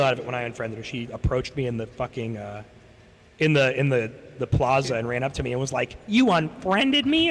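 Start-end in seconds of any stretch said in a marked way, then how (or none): 6.1-7.3 high, drawn-out mock outrage; indignant disbelief. Girl confronts the speaker in the plaza, mock indignant. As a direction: imitate an affronted, incredulous question, pitched way up.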